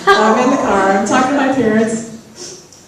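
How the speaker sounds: ordinary talk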